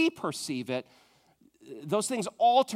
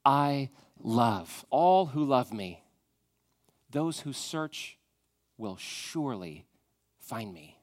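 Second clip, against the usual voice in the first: slow, tender reading; 5 semitones lower, slower than usual. Acted. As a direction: read it slowly like a promise spoken to you. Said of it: "another promise in Proverbs".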